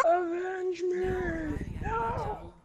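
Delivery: put-on dramatic dying old man. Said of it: "why did you sound like an old man".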